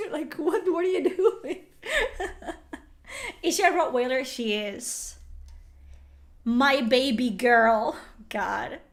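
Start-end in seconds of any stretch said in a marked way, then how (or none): 0.0-5.2 higher voice breaking into laughter; laughing. Real, not acted. Owner amused by what her dog is doing, laughing as she talks. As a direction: talk through the giggles. Laughing at dog's sleep pose.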